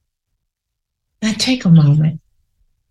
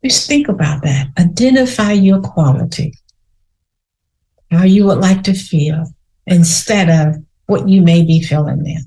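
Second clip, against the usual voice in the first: gentle, unhurried meditation guide; guided meditation. Acted. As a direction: lead listeners inward calmly. Leads a guided reflection.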